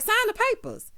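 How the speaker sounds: high-pitched upset squeal. Retold or imitated. Wife demanding husband sign the divorce papers, high-pitched and upset. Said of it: "that little high-pitched squeal that she does".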